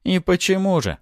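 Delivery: high, mocking question; smirking. A narrator's reading. Hero needling the angry Artara, smirking. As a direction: ask with a light sneer. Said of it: "усмехнулся я".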